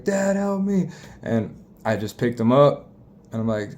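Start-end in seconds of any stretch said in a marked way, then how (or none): 0.0-0.9 son calling for help. Retold or imitated. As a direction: pitch up into a small boy calling out. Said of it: "And he's like".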